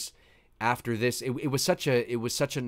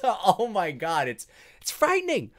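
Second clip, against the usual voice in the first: awed fright; 6 semitones higher than usual. Real, not acted. Reacting to shark attack scene.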